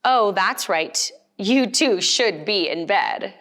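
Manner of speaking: ordinary delivery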